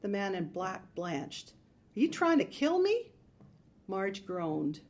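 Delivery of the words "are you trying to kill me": alarmed. Acted. Alarmed burglar protesting smoke.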